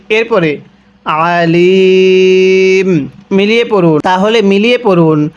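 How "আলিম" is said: Alim held very long on the ম; শেষ টেনে; drawn-out recitation ending. Shown as a demonstration. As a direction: stretch the final ম of Alim at length.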